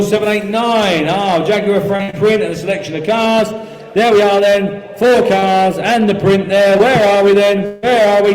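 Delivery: ordinary talk, nothing marked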